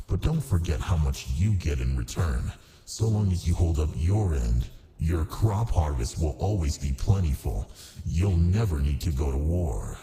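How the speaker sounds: ordinary delivery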